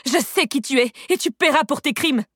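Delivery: high-pitched, ringing threat; vibrant hatred. A narrator's reading. Unseen woman threatens the fleeing killer, vibrant with hatred. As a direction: cry it out across the valley, full of hate. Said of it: "aux accents vibrants de haine".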